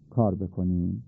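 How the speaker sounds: normal talking voice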